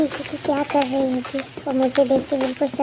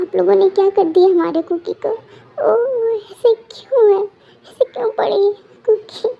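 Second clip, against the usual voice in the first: higher, half-sobbing plea; tearful sister; 5 semitones higher than usual. Acted. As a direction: cry out over her sister, voice breaking. Sister breaks down over Kooki. (रोती हुई बहन, टूटती आवाज़ में)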